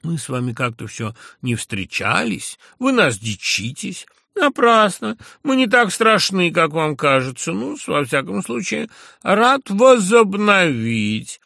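high, loud, drawn-out patronizing lisp; lisping drawl. A narrator's reading. A lisping drawl, patronizing the narrator as a general would. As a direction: stretch every word, lisping grandly. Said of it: "сюсюкивая и пришепётывая, и растягивая слова".

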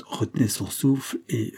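ordinary delivery

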